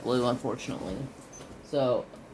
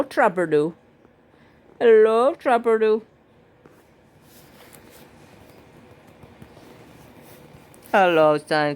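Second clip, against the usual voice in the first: much higher and louder pet voice; 8 semitones higher, 8 dB louder than usual. Real, not acted. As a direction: call to the dog excitedly. Holding and greeting his dog.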